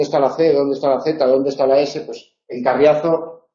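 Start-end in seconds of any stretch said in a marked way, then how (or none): none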